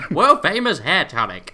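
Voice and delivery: loud Western huckster voice; cowboy twang. Pretend. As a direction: boom it like an old-time tonic seller. Hawking hair tonic, Western style.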